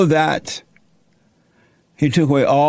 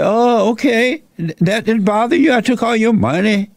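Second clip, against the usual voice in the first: Satan gloating to Job over his losses; taunting Satan; 6 semitones higher than usual. Acted. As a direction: sneer at Job like the devil.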